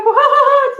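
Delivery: very high, loud startled what; a sudden alarmed cry. Retold or imitated. The father's startled cry at his daughter, loud. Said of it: "He's like".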